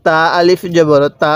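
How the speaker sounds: spelling chant with a stretched final "তা"; drawn-out vowel. Shown as a demonstration. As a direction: hold the last তা long. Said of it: "এক আলিফ টান দিব".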